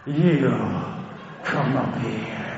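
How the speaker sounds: low, slow deliberate command; low commanding rapper. Retold or imitated. Rapper calling the boy on stage, slow low command. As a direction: point him out and order him up.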